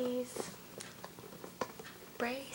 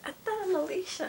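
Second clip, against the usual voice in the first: high quick teasing; excited; 7 semitones higher, 4 dB louder, faster than usual. Real, not acted. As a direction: tease her quickly. Teasing name mix-up.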